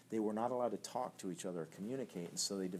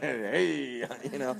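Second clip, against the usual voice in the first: louder, higher salesman's "hey"; used car salesman; 5 semitones higher, 6 dB louder, much slower than usual. Acted. Preacher imitating a used car salesman for the congregation, hammed-up salesman voice. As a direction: play a slick used car salesman's greeting. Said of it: "that is a used car salesman".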